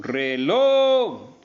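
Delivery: "reloj" with "loj" drawn out high and loud; stress on last syllable. Shown as a demonstration. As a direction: push and stretch the final "loj" of "reloj". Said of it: "tiene el acento en la última sílaba". ("reloj" con "loj" sostenido y en voz alta)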